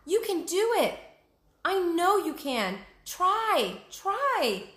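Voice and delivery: urgent encouragement. Acted. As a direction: coax her with rising urgency. Jack urges Annie.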